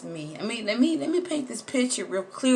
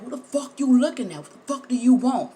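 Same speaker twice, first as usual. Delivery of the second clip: higher, louder confrontational comeback; pretend angry snap; 7 semitones higher, 7 dB louder than usual. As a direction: bark back at a stranger who stares. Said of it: "be like".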